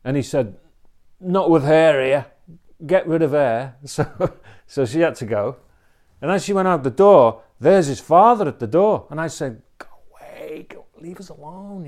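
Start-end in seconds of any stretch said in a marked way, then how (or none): 1.2-3.8 gruff demand. Retold or imitated. Man demanding wife leave. 9.8-11.9 hushed, breathy whisper, pushing him off; urgent whisper. Retold or imitated. Preacher whispering to the father at the door to go away.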